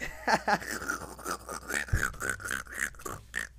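long helpless laugh trailing off; real laughing fit. Real, not acted. Cracking up at own story.

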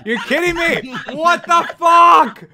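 loud frustrated yelling; frustrated shout. Real, not acted. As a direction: yell in disbelief at the crash. Game crashed, progress lost.

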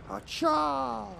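long, high-pitched "hacha" throw cry; mock warrior yelp, drawn out high; high battle cry.

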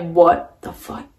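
just woke up, slowly and quietly stunned; stunned disbelief. Retold or imitated. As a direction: drag it out, dazed and baffled. Waking up from the dream.

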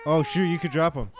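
loud, high alarmed cry; startled loud alarm; panicked yelp. Real, not acted. Panicked nearly dropping baby.

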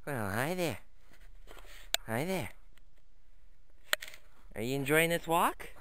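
usual speaking voice

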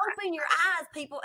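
hammed-up preachy shout to the crowd; mock rally lady shout. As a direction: call it out like a fervent rally believer. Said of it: "she's like".

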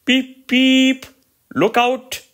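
high, loud whistle-like call; engine whistle call. A narrator's reading. Little engine whistling a warning at the station, high and loud. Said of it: "We whistle before every station".

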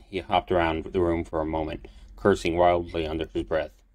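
usual speaking voice

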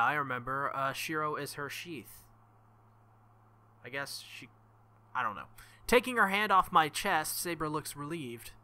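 ordinary delivery

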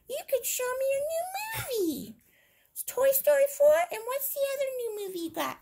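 high, fast squeaky toy voice; toy bed voice. Pretend. Voicing the bed toy to Hannah in a high squeak. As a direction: pipe up like a chatty little toy. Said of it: "Say hello, Bed".

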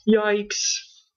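high, startled little yelp; gasped yikes. A narrator's reading. Scaredy Fran yelps in fright at being named. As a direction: jump in fright on the word. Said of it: "Scaredy Fran gasped".

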